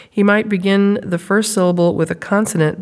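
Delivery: ordinary talk, nothing marked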